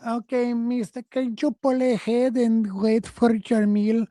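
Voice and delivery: put-on high, slow drive-thru worker voice, accented; mock drive-thru worker. Mocking the drive-thru worker to his co-host, high and accented. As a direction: ape the drive-thru worker asking politely. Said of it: "They'll go".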